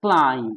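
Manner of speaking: क्लाइम with long "ai", falling, no b; silent-B pronunciation. Shown as a demonstration. Teacher answers what to read, क्लाइम with silent b. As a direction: end क्लाइम on a plain m. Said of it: "क्या पढ़ना है".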